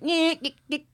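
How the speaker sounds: put-on high, nasal-free buzzing nyeh of an old printer; printer noise imitation. Telling friends the story, imitating the printer's whine. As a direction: mimic a dot matrix printer starting up.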